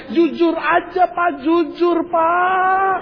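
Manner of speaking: high, wailing demand for honesty; hammed-up wifely whine; pleading wife. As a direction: beg like a distraught suspicious wife. Suspicious wife begs in acted quarrel.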